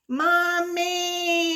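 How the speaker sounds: high, drawn-out cry for mommy; singsong scream for mommy; mock scream. A narrator's reading. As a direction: call out for help, hammed-up. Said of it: "Oliver screamed".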